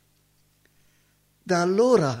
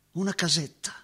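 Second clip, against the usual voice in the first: ordinary talk, nothing marked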